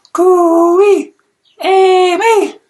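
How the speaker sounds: long high call across the room. A narrator's reading. Louie calling Amy across the room, a long high call. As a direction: sing the call out loud. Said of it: "he called her across the room".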